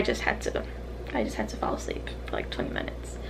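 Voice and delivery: sheepish low whisper. Real, not acted. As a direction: whisper the confession. Confessing the nap.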